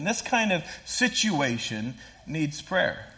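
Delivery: "this kind" stressed, slowed; spelled-out emphasis on "this kind of situation". Shown as a demonstration. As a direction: lean hard on "this kind" slowly. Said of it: "two words that I kinda wanna point out".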